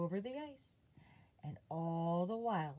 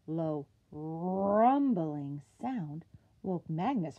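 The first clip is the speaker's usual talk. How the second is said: slow, heavy, rumbling narration; rumbling sound read slow and loud; 6 dB louder, slower than usual. A narrator's reading. Narrator reading to children, slow and booming.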